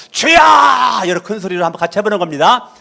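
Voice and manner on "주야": a sharp loud cry of "주야!" to show the way; loud shout. Shown as a demonstration. Pastor showing the congregation the loud cry. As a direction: call out "주야!" at full voice as the example. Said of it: "저랑 같이 큰 소리로".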